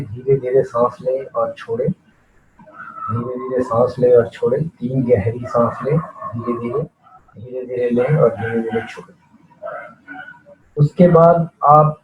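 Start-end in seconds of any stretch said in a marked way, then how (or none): 0.0-9.2 softly pace the listener's breathing; hushed, soothing meditation guidance; guided breathing. Real, not acted. Guiding slow deep breaths.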